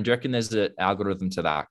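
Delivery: usual speaking voice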